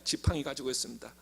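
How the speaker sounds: ordinary delivery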